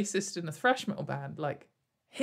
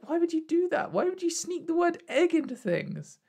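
exasperated disbelief; 9 semitones higher than usual. Real, not acted. Exasperated rant at a critic.

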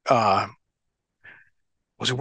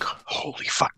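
breathless whispered disbelief; stunned under-the-breath curse; awed whisper; 10 dB quieter, much slower than usual. Retold or imitated. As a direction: hush it out like you can't believe it. Retelling his stunned reaction.

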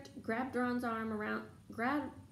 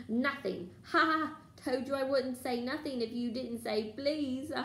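high cackling gloat; gloating laugh; 4 semitones higher, 3 dB louder than usual. Acted. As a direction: crow with glee.

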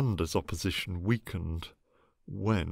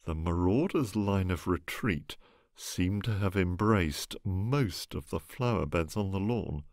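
ordinary delivery, nothing marked

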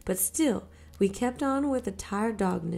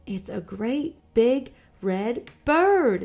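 louder, slow call up from the yard; 4 semitones higher, 6 dB louder, slower than usual. A narrator's reading. Doodle in the yard calling to the others about the bird, loud and slow. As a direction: call it out slowly to the family. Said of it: "he called".

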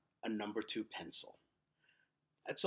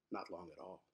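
much softer, lower, hollow repeat; near-whispered echo; 7 semitones lower, 14 dB quieter than usual. A narrator's reading. Narrator echoing the answer, much softer and darker. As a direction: fade it to a dark murmur.

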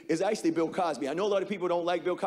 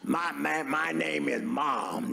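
put-on much lower, very slow toothless old lady; old comedienne greeting the crowd, slow and low; Moms Mabley impression; 4 semitones lower, much slower than usual. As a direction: drag it out low like an old woman with no teeth. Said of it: "and she'd go".

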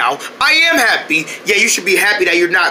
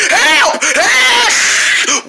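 panicked cry for help; 11 semitones higher than usual. Acted. Chased by the logo, he screams in panicked fright.

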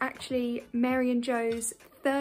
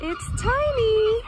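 excited; 11 semitones higher, 8 dB louder, much slower than usual. Real, not acted. Excited about tiny house.

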